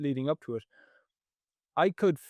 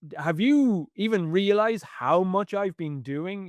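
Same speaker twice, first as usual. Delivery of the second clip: sudden defensive outburst; urge to blurt; 6 semitones higher than usual. Retold or imitated. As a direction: blurt it out, exasperated. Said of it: "just wanted to blurt out".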